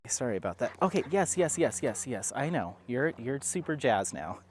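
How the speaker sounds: ordinary talk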